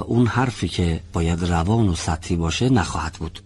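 ordinary talk

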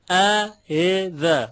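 اعذ very slow and drawn out, second hamza melting into an i; slow اعذ by syllables, no hard second catch; eased hamza like kasra. Shown as a demonstration.

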